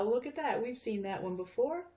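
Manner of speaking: normal talking voice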